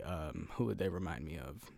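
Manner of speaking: ordinary talk, nothing marked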